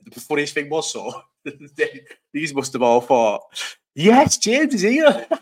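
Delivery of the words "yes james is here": high, slow, laughing sing-song mimic; hammed-up impression of her greeting; mock girl voice. Mocking the girl who greeted his mate, laughing.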